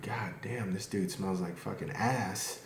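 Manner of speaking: hushed imitation of a disgusted passer-by; very soft mock grumble, slowing down; muttered bystander remark. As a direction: mutter it under your breath, as if behind someone's back. Imitates passers-by commenting on smell.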